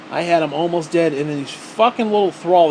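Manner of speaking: ordinary talk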